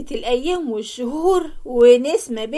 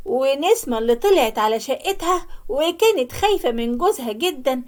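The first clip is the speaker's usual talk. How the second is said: ordinary delivery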